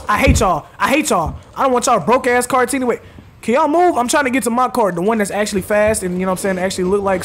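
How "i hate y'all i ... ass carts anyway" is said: normal talking voice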